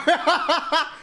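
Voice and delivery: high, real laughter. Real, not acted. Storyteller amused at getting far without talent, laughing. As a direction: burst out laughing at yourself.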